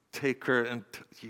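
ordinary talk, nothing marked

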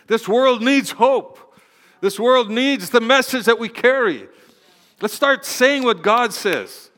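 high, loud rallying cry; full-force preaching. Real, not acted. Preacher rallying church to speak God's word, impassioned exhortation. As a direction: drive each line home to the congregation.

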